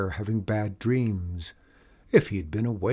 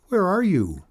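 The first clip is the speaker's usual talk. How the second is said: high, mildly nervous question; 9 semitones higher, 4 dB louder than usual. A narrator's reading. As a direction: ask with a touch of nerves, pitch lifted high. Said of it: "beautiful moose said nervously".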